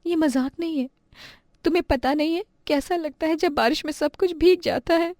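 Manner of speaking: put-on high child voice protesting; hurt little girl. Chhavi rebuking classmates for joking, hurt. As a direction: protest that the teasing hurts, high-pitched.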